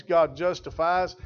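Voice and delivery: ordinary talk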